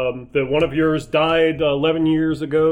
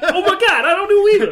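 much higher silly voice with a laugh; mock dumb voice; 6 dB louder, faster than usual. Friend mocks Arnold and Sly talking, silly high voice. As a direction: ham up a confused meathead.

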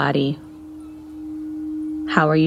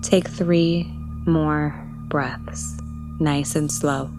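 slow and soft; 4 dB quieter, slower than usual. Acted. Slowing breaths in meditation.

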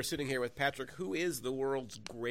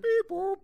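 robot beeps; 9 dB louder than usual. Acted. Repeating the sound effect.

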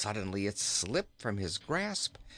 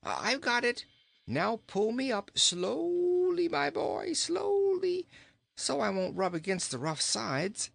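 calling out; 12 semitones higher than usual. A narrator's reading. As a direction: call up high, stretching the words. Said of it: "call out".

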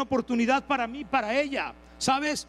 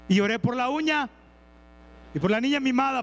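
usual speaking voice

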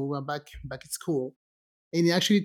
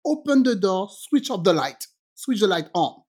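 usual speaking voice

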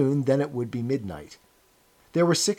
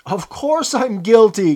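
loud, high, drawn-out protest; raised-voice outburst of admitted guilt; crying out; 8 semitones higher, 10 dB louder, slower than usual. A narrator's reading. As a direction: cry it out slowly and emphatically. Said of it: "cried Parsons".

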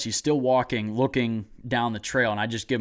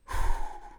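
long breathy blown-out sigh; long exhale. Retold or imitated. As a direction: blow out a big breath, acted. Retold shaky moment after shot.

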